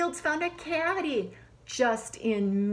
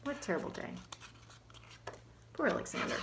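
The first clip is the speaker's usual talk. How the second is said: soft sympathy; 3 semitones higher, 11 dB quieter, much faster than usual. Acted.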